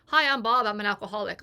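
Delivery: bright, loud rehab-group greeting; hammed-up meeting introduction. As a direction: chirp it like a group check-in. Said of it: "like that".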